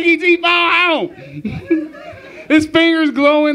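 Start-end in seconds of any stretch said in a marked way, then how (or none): 0.0-1.9 drawn-out slow alien plea; slow E T croak. Retold or imitated. E T begging to phone home, slow alien voice. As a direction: stretch it out like the movie alien. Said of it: "he said".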